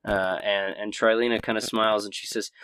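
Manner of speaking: usual speaking voice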